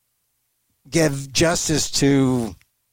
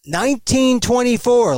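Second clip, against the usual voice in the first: higher and louder, said clearly again; repeated louder for a bad line; 7 semitones higher, 3 dB louder than usual. Real, not acted. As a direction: say it again over a bad connection. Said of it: "The Immigration Act of what?".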